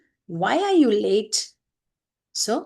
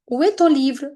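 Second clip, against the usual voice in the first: raised and louder, a teacher's direct question; asked as a direct question; 6 semitones higher, 5 dB louder than usual. Shown as a demonstration. Teacher demonstrating the professor's direct question, raised. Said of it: "Le professeur demande".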